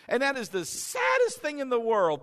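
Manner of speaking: normal talking voice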